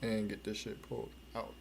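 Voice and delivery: normal talking voice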